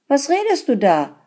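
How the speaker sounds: high, loud indignant question; sharp retort. A narrator's reading. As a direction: snap back at him in disbelief.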